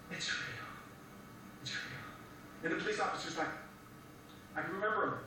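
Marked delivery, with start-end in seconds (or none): 0.1-2.6 whispered in awe. Retold or imitated. Preacher repeating what he told the doctors: awed whisper. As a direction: barely breathe it out, stunned. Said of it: "that's all I could say".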